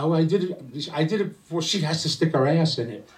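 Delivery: put-on girlish voice. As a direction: pitch it up like a breathless young girl. Second girl answers the nun.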